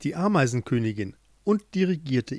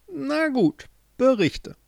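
much higher queen giving in grudgingly; relenting queen; 12 semitones higher, slower than usual. Acted. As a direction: allow him to speak, softened.

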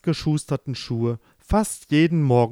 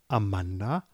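ordinary delivery

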